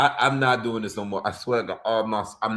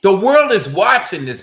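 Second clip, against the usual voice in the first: loud, raised rant; frustrated outburst; 6 semitones higher, 10 dB louder than usual. Real, not acted. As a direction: declare it in outrage. Ranting about the fight.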